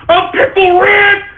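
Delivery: mocking a fan's frantic shrieks; shrieking Pickle Rick. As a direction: scream it like an unhinged fan on a counter. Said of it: "He starts saying crap like".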